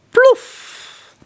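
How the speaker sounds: high, loud "Plof" like a hit; sound effect. A narrator's reading. Narrator voicing the arrow hitting the royal raft, loud. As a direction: pop it out as an impact.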